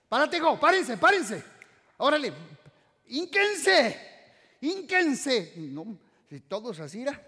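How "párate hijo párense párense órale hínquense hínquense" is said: high, loud urgent call to get up and kneel; mom's urgent shout. Retold or imitated. Mother rousing her children during a quake, urgent loud shout. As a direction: wake the kids in an earthquake, loud and high. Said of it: "Y mi mamá decía".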